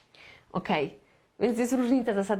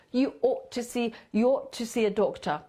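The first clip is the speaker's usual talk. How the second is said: ordinary talk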